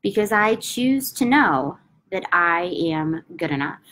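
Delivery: slow, calm affirmation with long pauses; measured, soothing repetition; slow guided affirmation. Acted. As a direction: lead them through the tapping mantra gently. Tapping affirmation script, long pauses.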